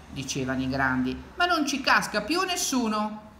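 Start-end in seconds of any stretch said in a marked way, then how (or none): 1.4-3.3 high-pitched retort; lightly acted scoffing; dismissive grown-ups. A narrator's reading. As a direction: refuse to be fooled by the boy. Grown-ups dismiss the boy's cries.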